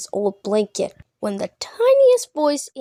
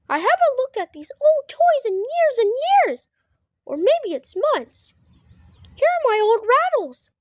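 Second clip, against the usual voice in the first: put-on much higher, childlike bear voice; Fozzie the bear; 13 semitones higher than usual. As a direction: play a delighted little Fozzie. Fozzie sorting old toys.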